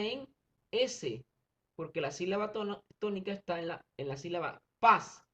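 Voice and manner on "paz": "paz" punched louder than the lead-in; stressed syllable. Shown as a demonstration. Teacher pointing out the tonic syllable, loud stressed "paz". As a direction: hit "paz" as the strong syllable. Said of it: "es la más fuerte".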